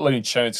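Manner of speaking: "Tunes" said as "chunes"; New Zealand pronunciation. Shown as a demonstration. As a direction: say Tunes the Kiwi way, starting with ch. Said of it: "'cause it's New Zealand".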